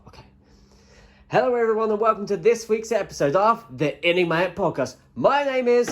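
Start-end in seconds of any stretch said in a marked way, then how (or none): 1.3-5.9 podcast announcer. Acted. Restarted intro, announcer voice.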